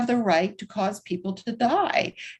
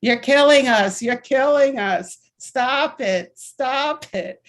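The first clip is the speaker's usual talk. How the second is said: half-shouted protest, louder at the start; retold scream; 4 semitones higher, 5 dB louder, slower than usual. Activist on the pew yelling at the cardinal, mock yell. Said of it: "started screaming at Cardinal O'Connor".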